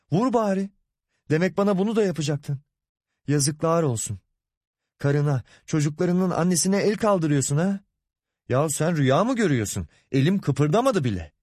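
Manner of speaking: çok tiz perdeden suçlama ve itiraz; incinmiş öfke. Acted. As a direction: sesini incelterek feryat eder gibi çıkış. El kaldırma suçlaması, kavga doruğu. (kavganın doruğunda tiz atışma)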